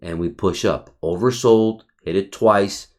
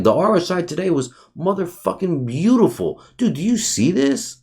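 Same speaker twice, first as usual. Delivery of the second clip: excited gushing; 9 semitones higher than usual. Real, not acted. Gushing over a beautiful chart.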